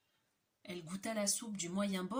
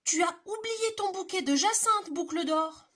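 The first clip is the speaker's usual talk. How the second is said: higher, louder medium bear call; middle bear voice; 10 semitones higher, 9 dB louder than usual. Acted. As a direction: call out kindly like mama bear. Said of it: "de sa moyenne voix".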